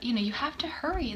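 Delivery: ordinary talk, nothing marked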